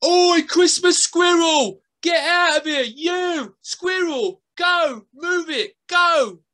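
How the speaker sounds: loud, high shooing yell; boy yelling at the squirrel to move; shouting boy. A narrator's reading. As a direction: shoo the squirrel off the road. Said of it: "said the boy".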